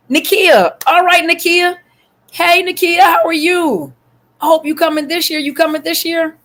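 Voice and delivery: light up as you spot her; excited greeting. Real, not acted. Excitedly greeting a viewer by name.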